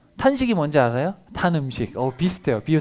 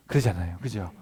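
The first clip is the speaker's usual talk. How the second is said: ordinary talk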